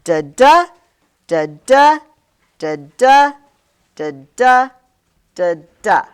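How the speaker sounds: loud, slow "da-da" five times, each second syllable punched; deliberate weak-STRONG "da-da", paused between; iambic pentameter beat. Shown as a demonstration. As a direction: boom out the second syllable of every "da-da". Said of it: "Every line is".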